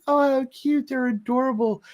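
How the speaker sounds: high sugary gushing over babies; cooing manager. Retold or imitated. Manager cooing at the twins, high and sweet. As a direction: gush like a doting lady.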